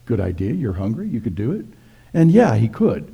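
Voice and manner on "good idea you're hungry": ordinary talk, nothing marked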